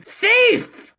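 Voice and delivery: high piercing scream of rage. Retold or imitated. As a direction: shriek it like an enraged dragon. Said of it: "he is going to scream".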